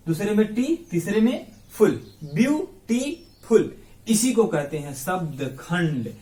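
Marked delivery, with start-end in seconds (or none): 2.2-3.7 beautiful very slow, in three higher beats; syllable by syllable. Shown as a demonstration. Teacher recaps the three parts, syllable by syllable. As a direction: pause after view and after टी in beautiful. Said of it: "एक बार में बोले ब्यू".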